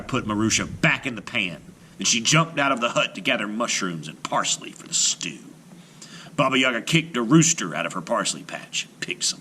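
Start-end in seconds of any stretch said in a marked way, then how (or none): none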